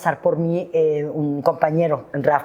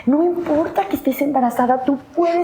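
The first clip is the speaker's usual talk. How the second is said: compañeros animando; 9 semitones higher than usual. Retold or imitated.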